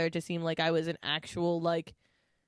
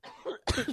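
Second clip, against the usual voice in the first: harsh coughing fit; choking cough; 7 semitones higher, 3 dB quieter than usual. Real, not acted. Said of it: "Sorry, I'm choking".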